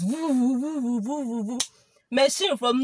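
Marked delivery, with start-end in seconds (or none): 0.0-1.6 rising then falling mock engine woo; motorbike siren imitation. Imitating the okada rushing at the children. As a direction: mimic a speeding bike approaching. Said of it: "What I heard is".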